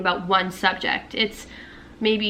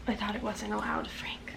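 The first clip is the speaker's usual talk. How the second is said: unvoiced whisper, hushed; 13 dB quieter than usual. Real, not acted. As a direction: whisper it to herself. Whisper heard.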